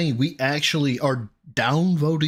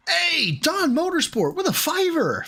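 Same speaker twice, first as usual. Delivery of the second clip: high, hyped announcer call; excited, stretched-out announcement; donor shout-out; 13 semitones higher than usual. Real, not acted.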